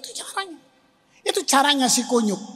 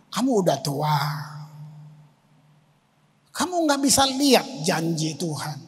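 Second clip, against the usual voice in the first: lower, hushed mocking voice of the tempter; devil's taunt; 7 semitones lower, 3 dB quieter than usual. The devil taunting the old pastor, low hushed sneer. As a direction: drip doubt into his ear, low and soft. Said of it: "Si kunyuk memang begitu".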